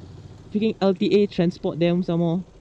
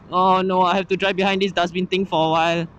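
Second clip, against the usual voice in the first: normal talking voice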